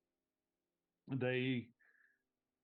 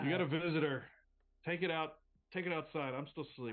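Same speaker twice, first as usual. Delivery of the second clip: grumpy roommate; 6 semitones higher, 4 dB louder than usual. Acted.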